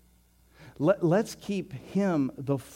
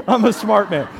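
laughing; 7 semitones higher, 12 dB louder than usual. Real, not acted. Laughs repeating his own joke.

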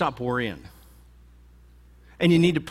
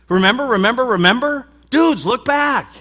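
loud, much higher, insistent repeats; urgent; 10 semitones higher, 11 dB louder than usual. Acted. Jesus urging his disciples to remember, near shouting. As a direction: hammer it home, slowing on look back.

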